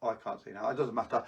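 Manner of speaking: ordinary talk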